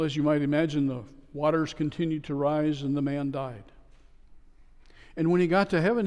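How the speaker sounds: ordinary talk, nothing marked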